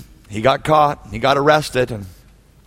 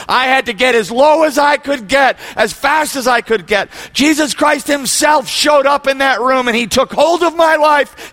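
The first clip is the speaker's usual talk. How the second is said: impassioned testimony; 9 semitones higher than usual. Retold or imitated. Convert's testimony, fervently retold.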